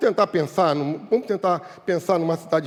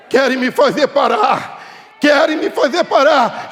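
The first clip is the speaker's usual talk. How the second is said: raised, drawn-out cry, climbing on the repeat; defiant preaching; 8 semitones higher, 7 dB louder, slower than usual. Acted. Believer defying those who would stop him, defiant preaching. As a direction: protest at being silenced, building.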